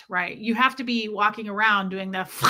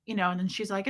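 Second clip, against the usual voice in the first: normal talking voice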